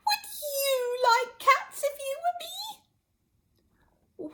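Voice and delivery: very high, slow tearful squeak; shrill passionate mouse. Acted. Mouse crying out at Alice. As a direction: wail it like a wronged mouse. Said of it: "in a shrill, passionate voice".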